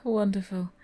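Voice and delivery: ordinary talk, nothing marked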